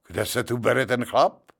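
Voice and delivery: ordinary delivery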